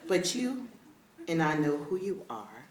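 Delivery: normal talking voice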